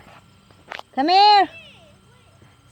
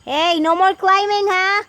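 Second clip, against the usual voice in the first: calling out; 5 semitones higher, 5 dB louder than usual. Real, not acted. Parent calling to climbing child.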